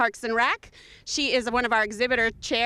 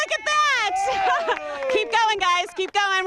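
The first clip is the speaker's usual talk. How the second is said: excited cheering; 9 semitones higher, much slower than usual. Real, not acted. Host cheering the eating contest.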